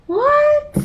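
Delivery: surprised. Real, not acted. Surprised at the broken couch.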